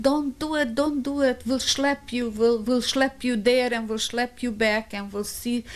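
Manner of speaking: high, insistent retold begging; urgent pleading. Women promising to carry the niece, urgent high pleading.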